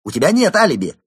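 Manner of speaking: loud, high, gloating announcement; delighted triumph. A narrator's reading. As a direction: crow it at the cornered suspect. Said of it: "обрадовался инспектор". (громкое ликование)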